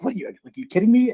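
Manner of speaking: can't believe the numbers, voice jumping up; disbelief. Retold or imitated. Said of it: "I'm just like".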